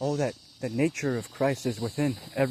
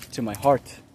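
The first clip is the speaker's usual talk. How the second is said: usual speaking voice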